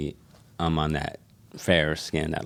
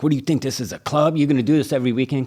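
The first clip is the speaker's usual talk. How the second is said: angry cop; 8 semitones higher, 6 dB louder, faster than usual. Retold or imitated. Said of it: "they're like".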